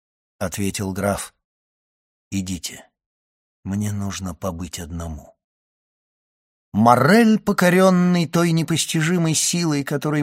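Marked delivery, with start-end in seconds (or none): 2.3-5.3 low, soft and subdued; quiet dismissal. A narrator's reading. Count asking his friend to leave him alone, quiet and low. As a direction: send him away softly.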